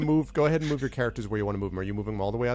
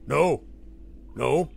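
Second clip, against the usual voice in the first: goblin answering in Orc; 2 semitones higher than usual. Acted. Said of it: "in Orcish, he says".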